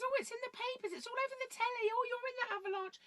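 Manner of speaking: high-pitched mum squealing about the papers and telly; excited mum. Retold or imitated. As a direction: play the mum gushing that her child is all over the news, voice jumping high.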